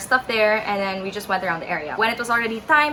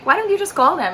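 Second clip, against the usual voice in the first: higher, pestering retold plea; nagging; 9 semitones higher, 3 dB louder, much faster than usual. Traveller pestering her sister to make the call, nagging. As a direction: badger in a raised pitch.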